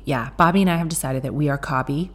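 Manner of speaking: usual speaking voice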